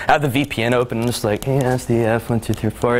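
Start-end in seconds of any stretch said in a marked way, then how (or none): 1.5-3.0 brisk run through the characters; quick repeating back. Acted. Executive repeating the password while typing, brisk mumbled readback. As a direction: rattle it off as if typing.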